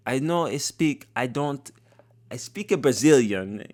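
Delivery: mocking her new Brazilian accent. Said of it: "the way she changed her accent".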